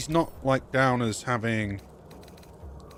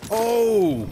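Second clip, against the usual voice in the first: surprised; 9 semitones higher, 7 dB louder than usual. Real, not acted. Enemy suddenly jumped, surprise.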